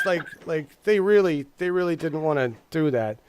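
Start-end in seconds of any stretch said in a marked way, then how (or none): none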